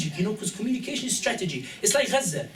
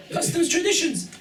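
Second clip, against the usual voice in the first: incredulous echo; 10 semitones higher than usual. Real, not acted. Mocking the guide's words.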